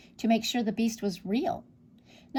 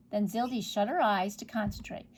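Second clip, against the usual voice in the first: usual speaking voice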